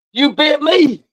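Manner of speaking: high, indignant holler after a bite; real angry outcry; outraged yell. Real, not acted. Bitten by the dog.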